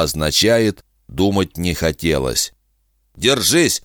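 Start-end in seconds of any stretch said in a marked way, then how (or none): 3.2-3.8 curt soldier. A narrator's reading. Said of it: "лаконично сказал Роудс".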